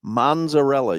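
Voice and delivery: "Mozzarella" as "MON-za-rella", with an N; mimicked mispronunciation. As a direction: copy the wrong "Mozzarella", N before a buzzed Z. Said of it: "you say, huh?".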